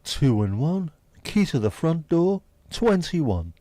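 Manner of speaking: booming bingo caller. Pretend. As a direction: call the numbers out across a hall. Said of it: "Doing me bingo voice".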